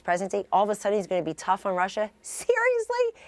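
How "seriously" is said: high, drawn-out scoffing "Seriously?", nearly laughing; mocking disbelief. Real, not acted. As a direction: stretch it out as if the idea is a joke. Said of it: "I can't even say it without laughing".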